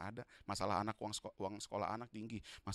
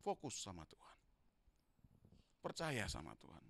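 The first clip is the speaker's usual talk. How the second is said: quiet echo; 4 semitones lower, 5 dB quieter than usual. Acted. Quiet echo after loud build.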